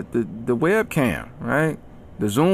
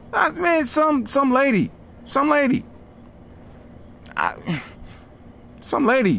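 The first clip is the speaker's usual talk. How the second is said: exasperated; 12 semitones higher than usual. Real, not acted. Exasperated rant about suggestions.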